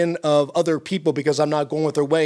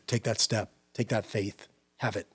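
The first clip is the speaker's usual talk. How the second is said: much softer, sincere plea; soft urging; 6 semitones lower, 10 dB quieter than usual. Real, not acted. Urging viewers to take that step, softly.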